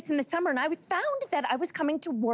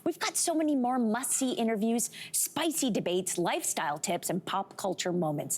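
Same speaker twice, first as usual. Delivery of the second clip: soft, close ad read inviting subscribers; promo outro read; 6 semitones lower, 3 dB quieter than usual. Acted. Host to viewers at the end, soft promo read.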